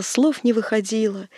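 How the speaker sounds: ordinary delivery, nothing marked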